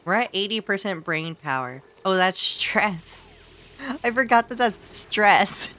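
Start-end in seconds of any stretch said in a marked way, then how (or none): none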